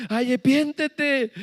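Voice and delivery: put-on high, slow tearful child; girl pleading with her brother; mock crying plea. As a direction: beg him to repent, close to tears. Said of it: "mi mamá empezaba a llorar".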